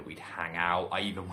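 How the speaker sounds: normal talking voice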